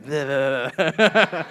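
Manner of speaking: silly rising snake blehs; hiss-like nonsense as a slithering snake; goofy creature sounds; snake noise. Pretend.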